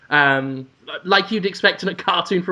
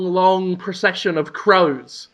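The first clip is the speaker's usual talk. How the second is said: ordinary talk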